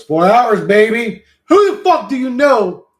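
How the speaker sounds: loud, high-pitched hyped-up brag; boastful shout. Pretend. Streamer bragging to the chat about four hours, boastful shout. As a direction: crow it at full volume, pitch jumping up.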